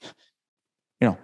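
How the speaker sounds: ordinary delivery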